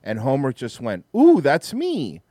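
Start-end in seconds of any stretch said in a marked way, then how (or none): 1.2-2.2 high, slow, goofy cartoon-dad coo; a drawn-out delighted "ooh" from a clueless dad; Homer's oblivious reaction. Describing a Simpsons joke to friends, doing Homer's slow high coo.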